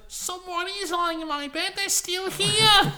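loud, very high little bear squeal; Baby Bear. Acted. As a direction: falsetto cub building to a long shriek. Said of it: "Baby Bear goes".

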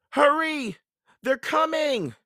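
high, loud cry of alarm; urgent call. A narrator's reading. Annie calls Jack from the rope ladder, urgent. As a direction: call out that danger is close.

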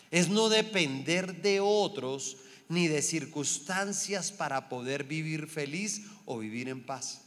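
ordinary delivery

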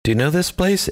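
normal talking voice

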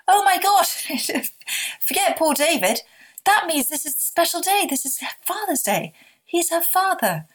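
high, emphatic burst of amazement; reliving a revelation, pitch leaping up; excited realization. Retold or imitated. Mother realizing the day's meaning, excited and amazed. Retelling her sudden joyful realization.

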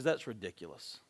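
low, hushed retold judgement; understated, low-voiced pronouncement; quiet verdict. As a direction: drop low and quiet, acting out a king's verdict. King passes judgement in retold parable.

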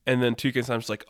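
normal talking voice

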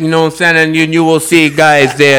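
put-on light Jamaican accent giving directions. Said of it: "I'm trying to find a Jamaican accent".

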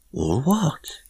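high, puzzled query; blank question. A narrator's reading. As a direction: ask it blankly, voice lifting high. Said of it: "she asked blankly".

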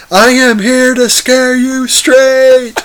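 hammed-up scare-you-straight yell; mock scream. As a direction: imitate Michael screaming at Phyllis. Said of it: "screams in her face".

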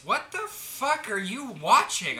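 mock appalled. Coworker catching him watching videos, imitated appalled question. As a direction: imitate someone aghast, stretching it out slowly.

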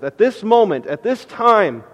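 loud, high, building sermon delivery; full-force preaching. Acted. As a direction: preach it out to the whole room.

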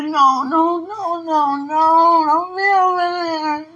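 high, very loud frightened pleading; panicked no. Real, not acted. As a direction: beg it to go away, terrified.

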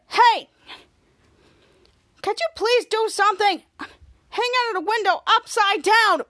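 high, loud interrupting shout; acted indignant call. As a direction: call out for attention in mock indignation. Vice mayor interrupts, hanging from window.

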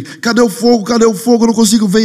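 high-pitched, frantic mimicry; frantic searcher. Man searching storyteller's house for fire, frantic. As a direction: act out a man hunting wildly for flames. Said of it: "ele fica louco, ele fica assim".

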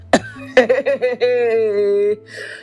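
long real laugh in disbelief; drawn-out incredulous laugh. Real, not acted. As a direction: laugh it off, amused and stunned. Laughing at the lady's reply.

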